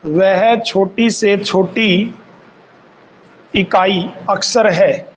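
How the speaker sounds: slow, word by word for note-taking; unhurried teacher dictation. Shown as a demonstration. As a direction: dictate each phrase slowly so students can write.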